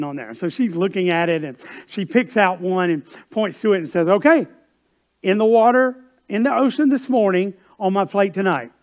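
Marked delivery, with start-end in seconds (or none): 4.1-8.7 put-on New York accent, louder, each phrase spelled out; a New York mother drilling the waiter; New York mom. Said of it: "they had that New York accent".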